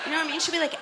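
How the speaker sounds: ordinary talk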